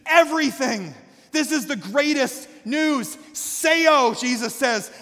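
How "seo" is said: raised, loud exclamation; proclaiming cry. Acted. Preacher voicing Jesus announcing the greatest news, proclaiming cry. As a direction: call it out as a proclamation.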